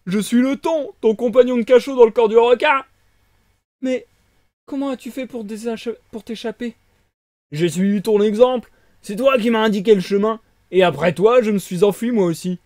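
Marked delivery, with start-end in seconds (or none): 0.1-2.8 loud, booming big fish voice; a hearty sea creature announcing itself; tuna voice. Acted. Tuna introducing himself to Pinocchio, loud and booming. 7.5-12.6 loud, booming big fish voice; a hearty sea creature announcing itself; tuna voice. Acted. Tuna introducing himself to Pinocchio, loud and booming.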